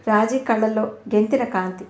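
ordinary delivery, nothing marked

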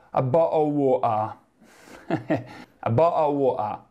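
"a bottle of water" in London slang, T and R swallowed; "a bottle of water" in Cockney, glottal stops throughout. Shown as a demonstration. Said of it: "A Londra ... ti verrà detto".

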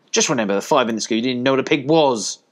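mildly sharp motherly telling-off; snapped. A narrator's reading. Mother dog scolding her puppies, mildly snappish. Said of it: "snapped Fly".

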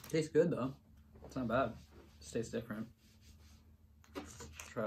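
chewy muffled words; talking while chewing. Real, not acted. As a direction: mouth partly full. Seen 3: Face scrunched, lips working as if chewing throughout the labelled words.

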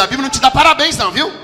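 ordinary talk, nothing marked

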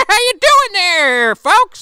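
high, loud, slow folksy greeting; mock grandpa at the grill; old Gramps voice.

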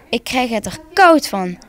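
usual speaking voice

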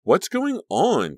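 high, loud, drawn-out question; demanding. A narrator's reading. Caroline, shocked and angry at fighting friends, demanding. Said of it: "Caroline demanded".

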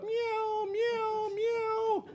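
very high cat meows; cat yowls. Acted. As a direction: yowl like an angry cat.

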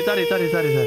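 urgent calling out. Real, not acted. Urgently pointing teammate to a spot.

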